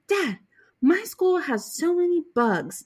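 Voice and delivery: a hint of a little girl telling dad; little daughter. Young daughter quoted at dinner.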